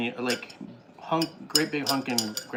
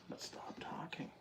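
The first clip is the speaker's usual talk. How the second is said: whispering; 17 dB quieter than usual. Real, not acted. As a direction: whisper so the mic misses it. Dad hushing child while recording.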